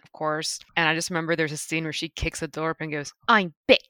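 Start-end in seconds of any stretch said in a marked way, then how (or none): 3.3-3.8 imitating a thick Kiwi accent; quoting the girl in a Kiwi accent; New Zealand accent. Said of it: "really thick New Zealand accent".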